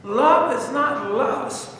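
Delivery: slow and firm, weight on "Love" and "lust"; slow preacher stress on "Love" and "lust"; slow emphasis. Real, not acted. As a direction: spell out "Love is not lust" word by word. Preaching the key point.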